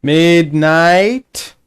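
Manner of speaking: long, loud, drawn-out call rising at the end; calling out loudly. Real, not acted. Player hailing unanswering teammate Midnight, drawn-out call. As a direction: stretch the name out to reach someone far off.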